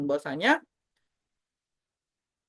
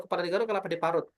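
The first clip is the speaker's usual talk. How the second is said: "Kepala digaruk, kelapa diparut" rattled off fast; fast tongue twister; 2 semitones lower, 4 dB quieter, much faster than usual. Shown as a demonstration. Host demos the top level of the drill, fast. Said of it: "Sampai di level terakhir".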